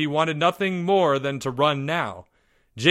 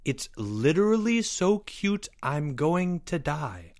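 slow, higher quoted gush; mocking quote; 5 semitones higher than usual. A narrator's reading. Leo quotes Albert's words back at him, mockingly. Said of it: "and I quote".

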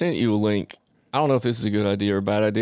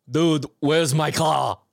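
put-on slow, raised Arnold-style Austrian accent; overdone Arnold accent, drawn-out words; Arnold Schwarzenegger accent; 4 semitones higher, much slower than usual. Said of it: "our man Arnold would have done it".

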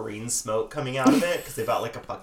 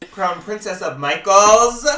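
mock grand title; 6 semitones higher, 10 dB louder, slower than usual.

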